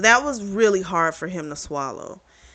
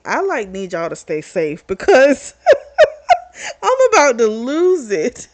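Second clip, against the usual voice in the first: loud, laughing mock mom, cracking up mid-line; cracking up while quoting mom; laughing through quote; 5 semitones higher, 8 dB louder than usual.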